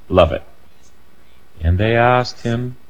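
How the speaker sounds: ordinary delivery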